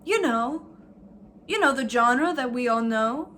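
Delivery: sarcastic. Acted. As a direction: gush over it with heavy sarcasm. Sarcastic mock enthusiasm.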